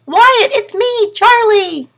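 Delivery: high, loud, slow little turtle call; a tiny turtle voice calling to his brother; squeaky little turtle shouting with joy. A narrator's reading. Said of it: "in his little turtle voice".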